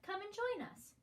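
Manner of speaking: high, bright little chick voice inviting; chirpy chicks. A narrator's reading. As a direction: squeak it up like tiny birds calling. Woodpecker chicks inviting bunny.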